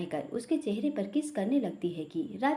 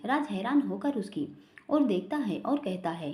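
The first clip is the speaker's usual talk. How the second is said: usual speaking voice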